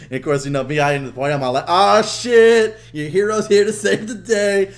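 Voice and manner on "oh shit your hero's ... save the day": loud, high mock-heroic boast; cocky. Joining his cousin's Halo game, cocky. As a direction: announce yourself like the big savior. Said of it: "cocky aspect of shit".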